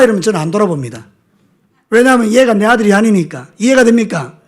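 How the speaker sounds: usual speaking voice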